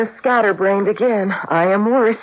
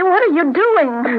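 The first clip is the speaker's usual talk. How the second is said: normal talking voice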